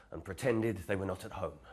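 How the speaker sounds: ordinary delivery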